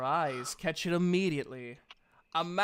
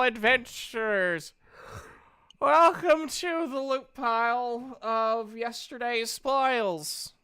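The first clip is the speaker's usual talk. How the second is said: higher-pitched shopkeeper voice, a weary seller listing wares; tired half-elf merchant; 6 semitones higher than usual. Acted. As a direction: play the sleepless half-elf eager to sell.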